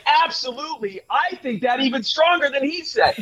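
high-pitched mock enthusiasm; hype man. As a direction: gush in exaggerated agreement. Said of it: "that's a vice presidential hype man".

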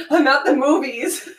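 breathless, mid-laugh; winded from laughing; out of breath. Real, not acted. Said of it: "I'm so out of breath".

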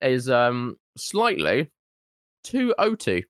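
talking through a biscuit; words round a mouthful; chewing while talking. Real, not acted. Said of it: "excuse my chewing".

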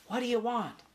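high, small mouse voice; little mouse, pitched well up; young mouse. A narrator's reading. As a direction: ask warily up high. Mouse answers boy, high voice.

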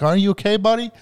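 put-on high, sing-song baby talk. Shown as a demonstration. Dad baby-talking to the teen driver by mistake. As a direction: coo it like to a toddler. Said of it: "like, baby talking".

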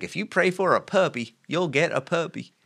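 high-pitched mock Southern preacher voice, slowing at the end; Joel Osteen, thick Southern accent. Repeating the preacher's promise to the co-hosts, mock Southern accent. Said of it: "Very, very thick".

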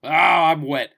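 loud, high mock-ecstatic moan; hammed-up cry of pleasure; turned-on henchwoman. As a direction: burst out in exaggerated arousal. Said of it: "betrayal gets her off".

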